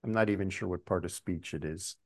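ordinary talk, nothing marked

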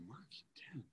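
unvoiced, puzzled whisper of a name; stunned whisper. Preacher racking his memory mid-call, stunned whisper. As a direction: whisper it to yourself, trying to place him.

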